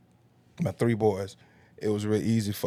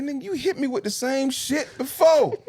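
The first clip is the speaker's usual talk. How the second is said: high-pitched, louder indignant retort; exasperated callout; 4 dB louder than usual. Retold or imitated. Catching the scammer's third try, indignant and loud. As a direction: call him out in loud disbelief.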